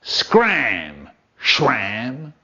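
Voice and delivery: low, falling snarl back; snarled retort. A narrator's reading. Tailor answering his bossy employer, snarled back. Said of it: "Sheddle snarled back".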